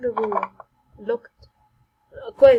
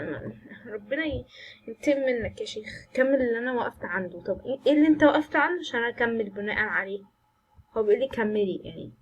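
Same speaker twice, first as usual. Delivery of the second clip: normal talking voice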